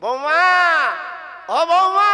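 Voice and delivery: loud high call of bৌমা. Acted. Preacher shouts a warning to daughters-in-law, loud and high.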